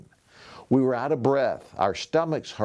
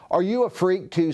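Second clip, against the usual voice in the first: sneering taunt; 7 semitones higher, 4 dB louder than usual. A narrator's reading. Bully sneering at Amos.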